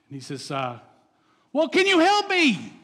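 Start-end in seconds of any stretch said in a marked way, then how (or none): 1.5-2.7 high, loud desperate plea; kid pleading. Retold or imitated. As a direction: beg from the branch like a scared kid.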